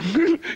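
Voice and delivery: a burst of high laughter; giggling lady's laugh; high mock laugh. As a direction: laugh out high like the amused lady. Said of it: "dit la gonzesse en riant".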